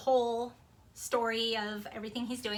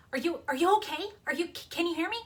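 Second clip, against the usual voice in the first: imitating a worried mom, higher and urgent; raised, stumbling mock panic; panicked; 6 semitones higher, 4 dB louder, faster than usual. Mom on a failing phone call checking on her child, panicked. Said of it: "she sounds really, really panicked".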